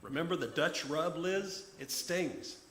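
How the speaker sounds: exasperated threat. Acted. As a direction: start with a loud burst, then keep pressing the threat. Exasperated James threatens Liz by phone.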